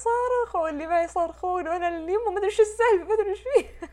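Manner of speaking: breathless and high, half laughing in fright; mock terrified babble; panicked retelling. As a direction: relive the panic of the screaming room. Retelling panic in haunted house.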